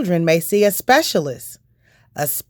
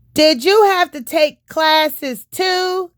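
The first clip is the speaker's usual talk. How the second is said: put-on higher and slow, a curious kid; child's voice; 10 semitones higher, slower than usual. Paul asking the doctor about school, slow higher child voice. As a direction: ask like a small boy.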